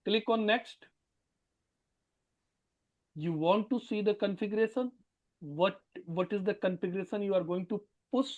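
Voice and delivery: faint and distant; too quiet to hear properly; barely audible. Real, not acted. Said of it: "Sir, your voice is very low".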